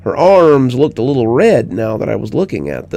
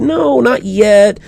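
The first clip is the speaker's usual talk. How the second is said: put-on high, slow child begging; lightly voiced little girl begging; pleading little girl; 10 semitones higher, slower than usual. A narrator's reading. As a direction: plead to stay up longer. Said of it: "she begged".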